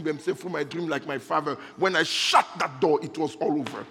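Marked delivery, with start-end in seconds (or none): none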